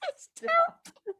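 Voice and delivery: tiny, very high squeak; guilty real laughter strangling the words; helpless laughter. Real, not acted. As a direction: choke it out while cracking up. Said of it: "I couldn't stop laughing".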